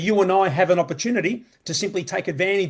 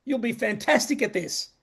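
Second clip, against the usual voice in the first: mock hype pitch; 6 semitones higher than usual. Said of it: "They tell you".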